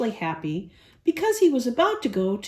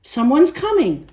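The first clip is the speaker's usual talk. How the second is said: loud excited yelp; bouncing with excitement, blurt it out; a yippy excited yelp; 3 semitones higher, 7 dB louder than usual. A narrator's reading. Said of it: "Muggsy yelped".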